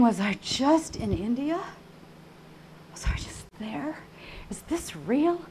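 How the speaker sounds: hushed, wondering questions; small, incredulous near-whisper; stunned disbelief. Retold or imitated. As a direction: ask it softly, rising in bewilderment.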